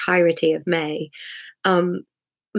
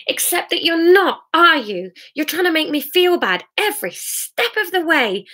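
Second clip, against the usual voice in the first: high, bitter, raised accusation; angry crying woman; 8 semitones higher than usual. Acted. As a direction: lash out, then tire. Crying Sarah lashing out.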